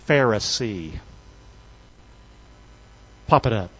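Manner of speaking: ordinary talk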